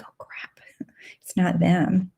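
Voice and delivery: hushed, breathless whisper of realizing; whispered realization. Retold or imitated. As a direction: whisper it as if caught out. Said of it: "And I was like".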